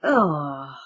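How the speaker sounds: long drawn-out sarcastic oh. Acted. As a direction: stretch the word out mockingly. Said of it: "He drew the word out".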